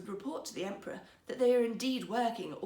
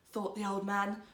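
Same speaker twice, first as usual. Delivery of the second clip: ordinary delivery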